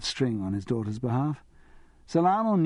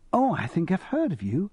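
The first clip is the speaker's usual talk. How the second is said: polite but uncertain; 7 semitones higher than usual. A narrator's reading. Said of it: "polite but uncertain".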